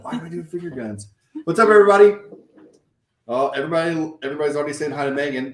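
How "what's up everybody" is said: big hype hello to the chat; loud greeting. Acted. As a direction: call it out loud to the viewers. Loud stream greeting to viewers.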